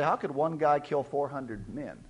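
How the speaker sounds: ordinary talk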